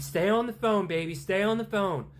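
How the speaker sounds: high, loud anxious comfort; urgent reassuring dad. Acted. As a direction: keep her on the line, urgently. Father running to daughter.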